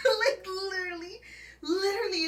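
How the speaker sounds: high, breaking laugh; laughing so hard it almost sobs; laugh-crying. Real, not acted. Telling friend she couldn't breathe, laugh-crying. Cry-laugh mid story.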